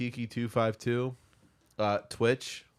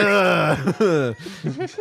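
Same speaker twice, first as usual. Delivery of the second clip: bursting out laughing; loud helpless laugh; loud laughter; 4 semitones higher, 7 dB louder than usual. Real, not acted. S0 cracking up at joke.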